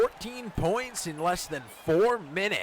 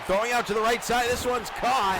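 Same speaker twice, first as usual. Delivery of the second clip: excited call; 5 semitones higher, 4 dB louder than usual. Acted. Live play-by-play burst.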